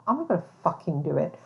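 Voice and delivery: usual speaking voice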